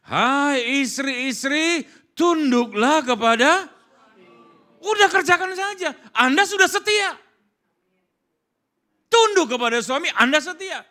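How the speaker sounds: loud, high proclamation; preaching at full force. Acted. Preacher commanding the wives, high loud proclamation. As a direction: thunder the command out from the pulpit.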